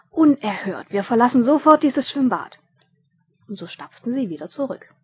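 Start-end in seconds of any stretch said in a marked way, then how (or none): 0.1-2.6 loud, outraged announcement; thundering indignation, raised in pitch; indignant outburst. A narrator's reading. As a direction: declare it loudly, deeply affronted. Indignant guest storms out of pool.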